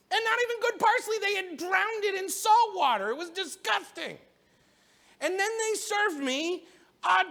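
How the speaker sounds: hammed-up disgust at a seder meal; outraged complaint. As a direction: rant about the awful food. Guy's outraged account of the food.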